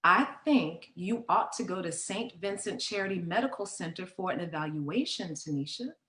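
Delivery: a hint of careful motherly persuasion; coaxing mother. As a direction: gently suggest it, as a mother would. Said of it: "And Mother said".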